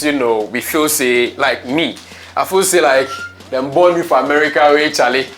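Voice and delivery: usual speaking voice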